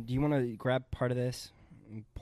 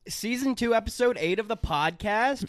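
podcast announcer; 9 semitones higher, 3 dB louder than usual. Acted.

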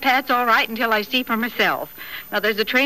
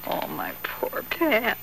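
breathless, voiceless lament, slowed; a mother's hushed fear after the letter; whispered worry; 7 dB quieter, slower than usual. Acted. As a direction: whisper it, heartsick for her son. Mother frightened by son's letter.

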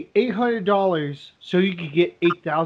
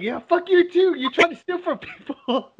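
pitched-up jokey fuck you too, teasing; mock angry comeback; 8 semitones higher than usual. Roommate cursing back at the scammers, mock angry. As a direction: fire it back at the scammers, playful. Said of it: "And he's like".

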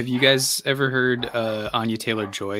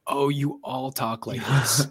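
higher-pitched mock realisation at an accent; retold surprise; 4 semitones higher than usual. Speaker retelling meeting her visiting friends, mock surprise. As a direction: play back your amazed reaction, pitched up. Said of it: "her friends came out to visit".

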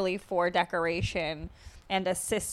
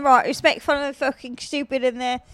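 mock British accent; 3 semitones higher, 4 dB louder than usual. Ranting about British people in a light mock British accent. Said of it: "your British accent's making me".

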